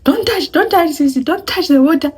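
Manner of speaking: high, loud urgent warning; sister-in-law urgently warning. Retold or imitated. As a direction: warn her off urgently. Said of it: "she's like".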